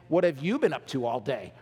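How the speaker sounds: low, pointed, suspicious question; accusing tone. Shown as a demonstration. Wife grilling her husband, accusing tone. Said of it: "Totally different just by the tone".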